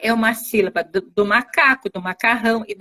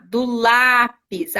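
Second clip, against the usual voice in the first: slow syllable demo; much slower than usual. Shown as a demonstration.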